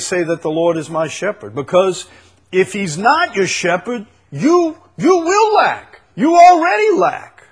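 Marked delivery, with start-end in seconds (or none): 4.3-7.4 high, loud preaching cry; full-force preaching. Acted. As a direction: drive it home at the top of the voice. Preacher rising to a climax.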